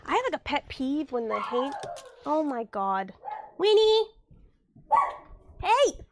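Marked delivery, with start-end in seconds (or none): 3.6-6.0 sharp loud call at the dog. Real, not acted. Owner yelling at her barking dog, loud sharp call. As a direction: yell high and loud to stop the dog.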